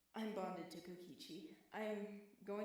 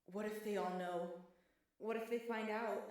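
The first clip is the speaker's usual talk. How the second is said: panicked; 2 semitones higher, 6 dB louder than usual. Acted. Panicked inner thoughts.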